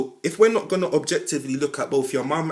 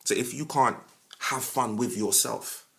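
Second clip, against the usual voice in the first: ordinary talk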